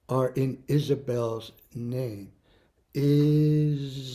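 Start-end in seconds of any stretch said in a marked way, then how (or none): 2.9-4.2 slow, drawn out Isabel; syllable by syllable. Shown as a demonstration. Teacher counting syllables of student's name, slow. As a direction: stretch Isabel into its beats. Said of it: "how many syllables".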